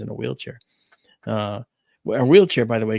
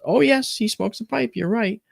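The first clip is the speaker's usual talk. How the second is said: high, bright confirming; grandmother's voice; 10 semitones higher than usual. Retold or imitated. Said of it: "She goes".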